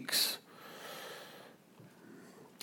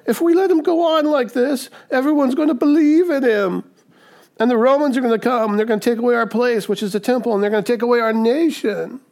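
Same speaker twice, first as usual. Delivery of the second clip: whining leaders; 8 semitones higher than usual. Retold or imitated. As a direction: whine like scared officials fearing Rome. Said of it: "And they start whining".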